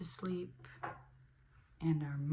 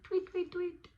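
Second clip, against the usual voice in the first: high chirpy bird tweets; tweeting like a small bird; 11 semitones higher than usual. Acted. Imitating bird sounds.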